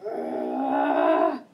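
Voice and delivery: deep monster groan; mummy groan. Acted. Mummy appears.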